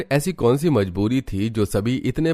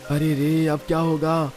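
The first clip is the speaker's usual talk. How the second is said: high, alarmed call to wake everyone; acted flood alarm, pitched up; 7 semitones higher, 3 dB louder than usual. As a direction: raise the household in panic, voice climbing. Waking family as house floods.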